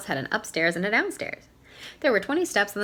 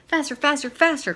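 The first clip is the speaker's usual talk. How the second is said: high, louder urging; rising chant of faster and faster; urgent cry; 7 semitones higher, 4 dB louder than usual. Acted. Owl pushing himself up and down the stairs, rising urgent cry. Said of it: "cried Owl".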